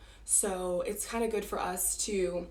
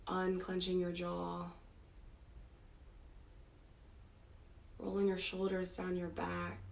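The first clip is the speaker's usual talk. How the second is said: soft meditation voice, sinking quieter toward the end; guided meditation; 8 dB quieter than usual. Real, not acted. Teacher relaxing the group's bodies part by part, soft guided meditation. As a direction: lull them gently, phrase by phrase with long pauses.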